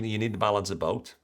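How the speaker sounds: ordinary talk, nothing marked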